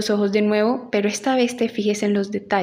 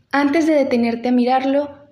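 usual speaking voice

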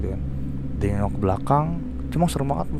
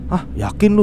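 high, sharp "hah" of disbelief, then doubting; startled disbelief; 10 semitones higher, 5 dB louder than usual. Retold or imitated. To his friend after hearing the myth, startled disbelief. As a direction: jolt up in pitch as if you can't believe it.

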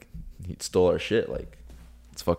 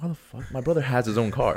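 retold disbelief; 7 semitones higher than usual. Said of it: "I'm like".

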